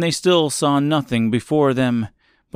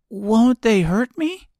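put-on high, slow anxious girl voice; 11 semitones higher than usual. A narrator's reading. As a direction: ask worriedly as a young girl. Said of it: "asked the girl anxiously".

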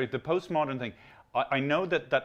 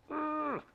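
high-pitched "ugh" of disdain; mock disdainful noise. Comedian mimicking postmodern types' remove, mock disdain. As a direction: imitate an aloof, disgusted noise. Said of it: "the postmodern thing, it's like".

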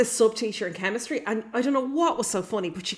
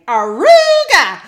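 very loud, slow, high drawn-out call; shouting; 7 semitones higher, 15 dB louder, much slower than usual. Retold or imitated. Student shouting a silly catchphrase in the halls, loud. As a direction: bellow it down the school hall. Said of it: "shouting this".

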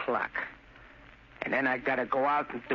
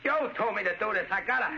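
raised pitch, insistent; agitated defiance; 6 semitones higher than usual. Acted. Son defies those stopping his mission, agitated. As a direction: push back at the interference.

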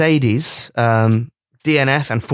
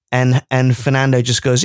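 ordinary talk

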